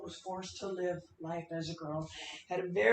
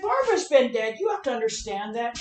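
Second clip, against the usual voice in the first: retold arguing; 3 semitones higher, 10 dB louder than usual. Adult child arguing back at mom, retold with raised voice. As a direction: argue back, raised voice at the start. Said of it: "And I argue with her".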